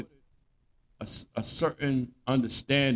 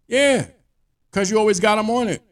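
high, loud, rapid comeback; exasperated retort; 10 semitones higher, 8 dB louder than usual. Acted. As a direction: fire back at the manager, fed up.

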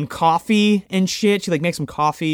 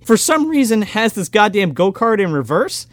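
normal talking voice

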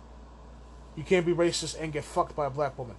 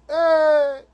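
attention shout; 9 semitones higher, 11 dB louder than usual. Retold or imitated. Calling out, hand up.

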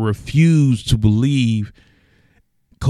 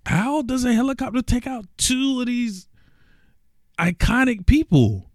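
freaking out; 13 semitones higher than usual. Retold or imitated. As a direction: plead in shocked disbelief at the news. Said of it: "I'm freaking out".

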